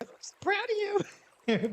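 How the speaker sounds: a mother cooing over her son's grade; gushing mom. Retold or imitated. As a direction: falsetto impression of a proud mom. Mom gushes over son's score.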